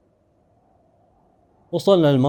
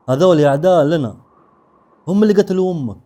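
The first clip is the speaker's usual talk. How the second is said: loud, higher-pitched accusation; vehement warning; 6 semitones higher, 9 dB louder than usual. Acted. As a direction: drive the warning home forcefully. Grandfather warns against his enemies. (تحذير بانفعال)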